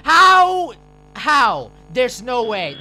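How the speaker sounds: loud angry outburst; frustrated shout. Real, not acted. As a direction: yell at the game in disbelief.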